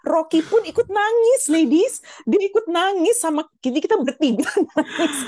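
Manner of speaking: high and loud, laughing through it; squeaky amused retelling; amused high telling. Real, not acted. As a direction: tell the dog part high, laughing. Even the dog cried.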